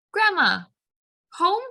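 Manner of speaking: a mother mimicking her daughter; toddler voice. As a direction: ask for grandma like a little kid. Toddler asking for grandma.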